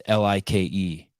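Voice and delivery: "L I K E" said letter by letter; spelled out. Shown as a demonstration. Host spelling out the word to show his meaning, letter by letter. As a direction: spell out L I K E, one letter at a time. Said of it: "the topic there is".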